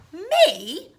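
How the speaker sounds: high, loud, affronted question; incredulous. Acted. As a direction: ask it in posh disbelief.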